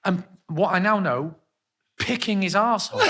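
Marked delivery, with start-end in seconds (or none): 2.0-3.1 louder, higher reveal; shouted punchline. Real, not acted. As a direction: land the gross punchline. Punchline of gross story.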